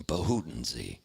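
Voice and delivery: low, imitated dying man; a hint of a dying old man; dying father's utterance. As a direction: utter it deep and solemn, like a last word. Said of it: "he coughs, and he goes".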